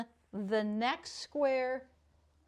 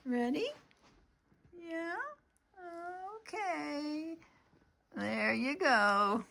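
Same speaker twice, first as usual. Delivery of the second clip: talking to a dog; 4 semitones higher, 3 dB quieter than usual. Real, not acted. Giving the dog its treat.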